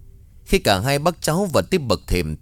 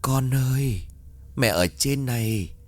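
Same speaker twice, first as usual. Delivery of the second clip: eerie mother's voice; 3 semitones higher, 3 dB quieter than usual. Acted. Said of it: "có tiếng người vang lên".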